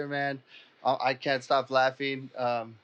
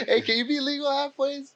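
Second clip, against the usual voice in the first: laughing question; 10 semitones higher than usual. Real, not acted.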